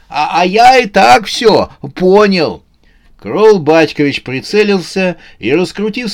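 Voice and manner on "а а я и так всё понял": high, stammering protest; shouted on the run. A narrator's reading. Новый год protests to his pursuers, shouting. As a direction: cry it back while fleeing. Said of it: "отвечал на бегу".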